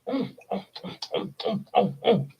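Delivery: quiet grunting munching noises; wordless eating sounds, gulping down food; mock gobbling. Acts out Esau gobbling the stew.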